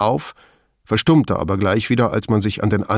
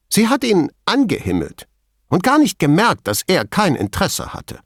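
high, emphatic, defiant claim; challenging; 9 semitones higher than usual. A narrator's reading. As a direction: assert it, allowing no doubt. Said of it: "mit herausfordernd gerecktem Kinn". (herausfordernd, keinen Zweifel zulassend)

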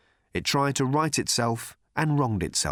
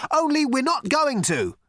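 high-pitched shout over the chaos; 4 dB louder than usual. A narrator's reading. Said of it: "shouted Ford".

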